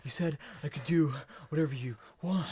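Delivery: mock breathless complaint; out of breath. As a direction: act winded, gasping between the words. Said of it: "she's, like, out of breath".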